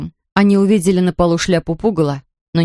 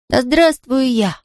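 high, slow, triumphant self-praise; proud little hurrah; self-congratulation; 6 semitones higher, slower than usual. A narrator's reading. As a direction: cheer for yourself, drawn out. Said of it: "похвалил себя Людвиг четырнадцатый".

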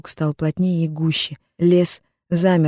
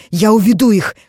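louder, higher urgent call; urgent cry; 4 semitones higher, 6 dB louder than usual. Acted. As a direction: urge her to hide. Mats decides to lure pursuers.

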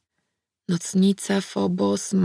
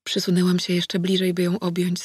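ordinary delivery, nothing marked

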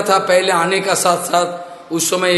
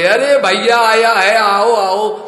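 hearty welcoming call; 4 semitones higher, 6 dB louder, slower than usual. Acted. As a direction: call out loudly and a bit higher, with enthusiasm. Shiva welcomes the visitor.

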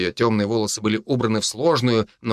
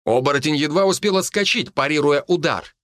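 usual speaking voice

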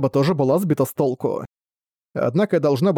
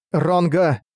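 commanding name call; 3 semitones higher than usual. Acted. Speaks the true name.

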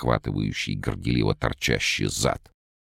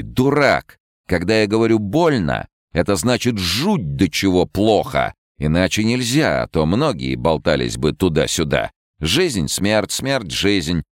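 fortune teller; 6 semitones higher than usual. Acted. Rona scolds.

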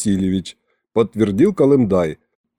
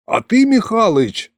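mildly angry, high-pitched reproach; suddenly cross; 10 semitones higher than usual. A narrator's reading. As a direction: flare up a little, pitch jumping. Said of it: "рассердился вдруг голос". (сердито, высоким голосом)

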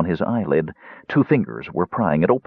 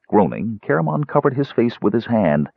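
plain reading voice

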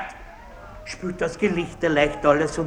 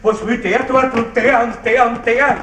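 furious outburst; 6 semitones higher than usual. Acted. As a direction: lash out at the innkeeper. Baron rages at innkeeper. (Baron tobt den Wirt an, wütend und laut)

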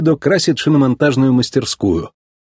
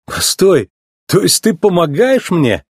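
higher, incredulous question; stunned; 7 semitones higher, slower than usual. A narrator's reading. Racer learns Sally is helping him, stunned. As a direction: check it in astonishment. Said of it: "Гонщик был потрясён".

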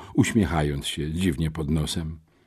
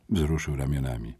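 ordinary delivery

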